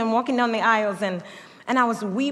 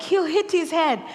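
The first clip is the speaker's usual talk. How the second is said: higher, louder anxious cry; a nervous mother's higher-pitched worry; worried parent; 7 semitones higher, 4 dB louder than usual. Retold or imitated. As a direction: fret that the baby will fall. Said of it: "I'm like".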